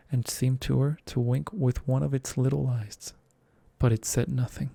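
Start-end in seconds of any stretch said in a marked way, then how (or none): none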